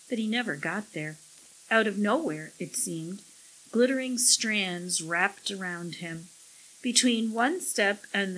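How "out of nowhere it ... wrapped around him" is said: ordinary delivery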